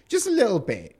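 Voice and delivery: mocking, high and loud; sarcastic. Real, not acted. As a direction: sneer at the excuse. Mocking the blackmailer's claim.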